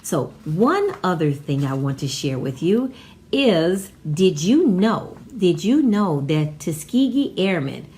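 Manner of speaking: ordinary talk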